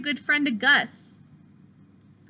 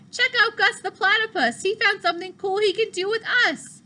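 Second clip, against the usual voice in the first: excited; 8 semitones higher than usual. Acted. Pete showing off Gus to friends, excited and higher. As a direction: cheer him on with joy.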